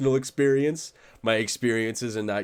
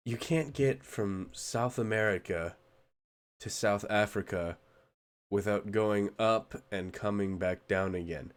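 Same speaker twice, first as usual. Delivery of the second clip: ordinary talk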